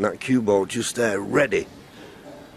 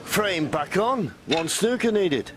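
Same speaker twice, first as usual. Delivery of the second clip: excited; 10 semitones higher, slower than usual. Real, not acted. Frame back on, building tension.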